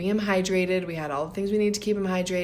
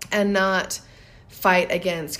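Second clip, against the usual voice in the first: usual speaking voice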